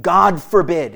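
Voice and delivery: "God forbid" said loud, "God" punched; strong repudiation, "God" stressed; emphatic "God forbid". Shown as a demonstration. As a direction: hit "God" hard in "God forbid". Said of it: "is the idea".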